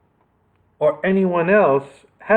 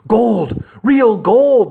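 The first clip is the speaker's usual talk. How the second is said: high, louder greedy shriek; shrieking with delight; 7 semitones higher, 5 dB louder, much slower than usual. A narrator's reading. Robber spots gold in Nick's hand, shrieking with delight. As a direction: cry out at the sight of gold. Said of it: "the robber shrieked in delight".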